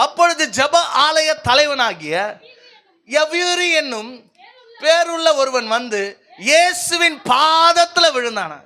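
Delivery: high, ringing pulpit recitation; declaimed scripture. Acted. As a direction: proclaim the verse phrase by phrase.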